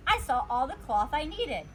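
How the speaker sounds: usual speaking voice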